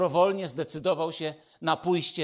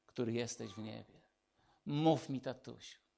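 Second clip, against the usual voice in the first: soft, gentle invitation from a loving father; tender fatherly voice; 5 semitones lower, 9 dB quieter than usual. Retold or imitated. God inviting his child to call him daddy, soft and tender. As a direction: say it softly, like a dad calling his child close. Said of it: "Pan Bóg mówi do ciebie".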